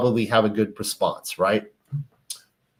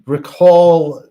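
louder, higher and insistent; emphatic recall; 4 semitones higher, 7 dB louder than usual. Retold or imitated. The wife pleading with her husband, loud and insistent. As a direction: hit the word Recall hard and high. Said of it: "So she's saying".

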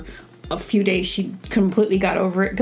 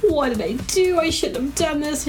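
higher-pitched wail of doubt; mock regret; 7 semitones higher than usual. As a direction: imitate a friend's mom moaning with regret. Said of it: "she was feeling so".

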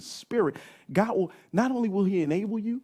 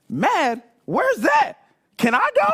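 put-on high, loud kid voice full of confusion; a little kid baffled and indignant; puzzled child; 5 semitones higher, 4 dB louder than usual. Young son wondering aloud where mom goes, high and loud.

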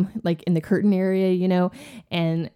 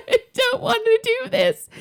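put-on whiny teen. Teen self quoted to dad.

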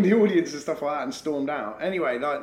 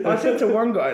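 laughs as he starts the story, higher and louder; laughing; 8 semitones higher, 7 dB louder than usual. Real, not acted. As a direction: still laughing through the words. Laughs at the memory.

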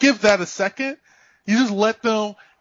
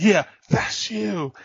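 taunting; slower than usual. Retold or imitated. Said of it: "And I'm like".

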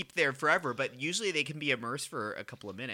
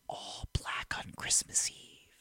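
whispering; 7 dB quieter, slower than usual. Real, not acted. Aside while others discuss movie.

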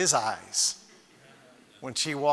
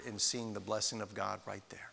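whisper; 7 semitones lower, 9 dB quieter than usual. Acted. Preacher drops to whisper.

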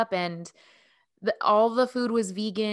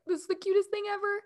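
gushing; 11 semitones higher, 4 dB quieter, faster than usual. Retold or imitated. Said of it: "but I was like".

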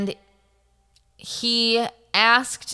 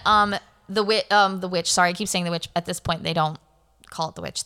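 normal talking voice

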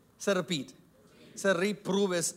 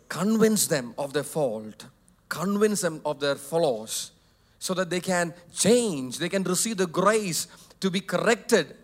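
normal talking voice

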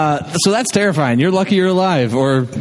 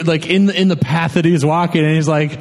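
usual speaking voice

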